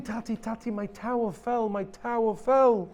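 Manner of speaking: lightly voiced child's whimpering wail; a hint of a sobbing little boy; child crying. A narrator's reading. Child telling his father about the fallen tower, lightly whimpering. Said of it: "the child is screaming".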